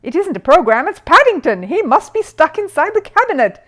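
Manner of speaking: high, loud excited cry; excited discovery. Acted. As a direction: blurt out the discovery in alarm. Jonathan discovers Paddington inside.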